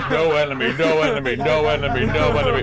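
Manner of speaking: loud, repeated scanner chant; robotic scan readout. Acted. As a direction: call it like a targeting computer.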